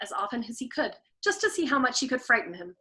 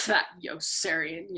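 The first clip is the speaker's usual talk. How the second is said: slow, lower mock echo of the officers; mocking mimicry; 5 semitones lower, slower than usual. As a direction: lightly parrot their laugh back at them. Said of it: "Yossarian mimicking them".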